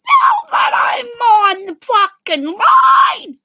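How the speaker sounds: high, loud, slow crying yell; a tearful furious yell at the chat; angry crying shout. Real, not acted. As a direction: wail it out in fury.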